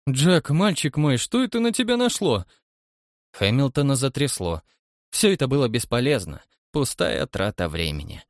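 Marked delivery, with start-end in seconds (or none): 0.1-2.4 alarmed, faltering question. A narrator's reading. Shocked doctor asking his young colleague what's wrong, alarmed. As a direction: ask in rising dismay. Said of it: "Заикаясь, он спросил".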